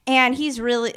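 usual speaking voice

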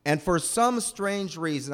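ordinary talk

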